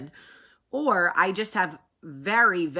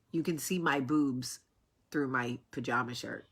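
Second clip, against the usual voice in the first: hushed; 6 semitones lower, 6 dB quieter than usual. Retold or imitated. Hushed confession to husband.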